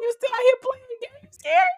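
very high-pitched playful incredulity; teasing, hammed-up telling-off; mock scolding. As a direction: call him out in squeaky mock disbelief. Playfully scolding the absent Bachelor.

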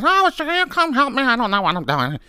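put-on high, then falling, bewildered old woman; high flustered plea; helpless clerk. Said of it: "She says".

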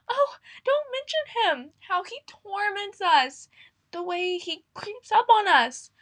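high, trembling squeak of fear; fearful plump mouse, voice quivering; quivering, frightened. Acted. Plump mouse frets to the others, high and quivering. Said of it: "quivering like jelly".

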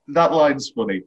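normal talking voice

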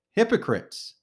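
loud, high shout of accusation; yelling at the crowd. Acted. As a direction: hurl the word Hypocrites at them. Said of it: "He yells at the crowd".

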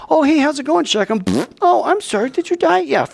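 high sing-song taunting greeting; mock cheerful killers. Simeon and Levi taunting Shechem as they kill, high mock cheer. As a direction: taunt sweetly while striking. Brothers mocking while killing.

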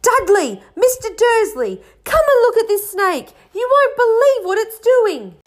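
high, loud shrieking call; excited shout. A narrator's reading. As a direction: yell across the reptile house. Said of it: "a deafening shout behind Harry".

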